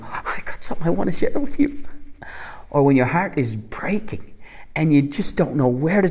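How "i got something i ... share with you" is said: higher, eager burst of news; can't wait to tell; excited sharing. Retold or imitated. Friend calling a close friend, bursting with joyful news.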